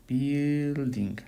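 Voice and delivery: "Building" stretched out, first syllable held long; drawn-out word. Shown as a demonstration. Teacher fixing a missing U, "Building" slowly drawn out. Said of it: "aquí me faltó la U".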